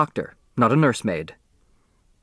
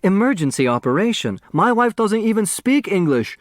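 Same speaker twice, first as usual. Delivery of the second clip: pleading; 8 semitones higher than usual. A narrator's reading. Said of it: "Both pleaded for their absent spouses".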